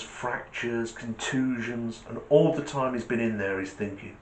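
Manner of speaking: ordinary delivery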